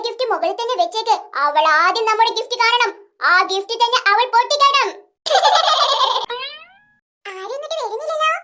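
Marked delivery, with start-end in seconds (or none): 5.2-6.5 scheming laugh. Acted. Plotting laugh.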